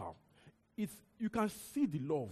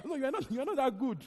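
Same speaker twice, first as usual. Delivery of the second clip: higher and louder, laughing through it; laughing tease; 6 semitones higher, 5 dB louder than usual. Real, not acted. Preacher teasing listeners about their goodness, laughing. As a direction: tease them while laughing.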